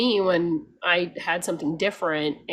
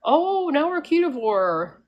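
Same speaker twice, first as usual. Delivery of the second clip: higher, slowed mock announcement; mocking thumbnail voice; 7 semitones higher, 4 dB louder than usual. Mocking diet-switching YouTubers, higher slowed parody. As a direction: parody a flip-flopping channel, higher and drawn out. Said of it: "thumbnails that go".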